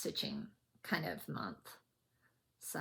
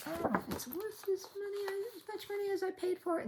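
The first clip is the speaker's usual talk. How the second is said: high quoted inner thought; 13 semitones higher than usual. Retold or imitated. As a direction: voice your past doubt. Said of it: "I was thinking".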